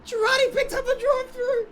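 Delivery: high, loud excited call; excited shout. Real, not acted. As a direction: shout the news with delight.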